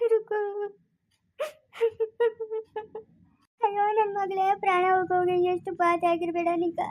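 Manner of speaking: wailing for a dead daughter; tearful, grief-stricken mourning; sobbing lament. Acted. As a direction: cry through the words with sobs between phrases. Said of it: "ಅಳ್ಬೇಡ, ಸುಮ್ನೆ ಇರಮ್ಮ".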